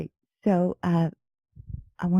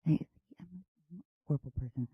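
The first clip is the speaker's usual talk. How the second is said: dark sexy voice; 10 dB quieter than usual. Acted. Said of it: "I'm gonna go with dark".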